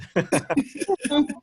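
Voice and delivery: bursting into a high laugh. Real, not acted. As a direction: laugh out at the joke. Laughing at the accent joke.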